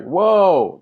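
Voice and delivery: loud, high astonished whoa; amazed whoa. Retold or imitated. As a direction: blown away, let it out. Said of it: "and I was just like".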